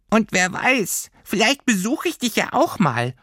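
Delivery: very high, slow creature farewell; fond little creature. Acted. Creature wishing Lilli well before leaving, high and slow. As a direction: wish her well like a tiny friend.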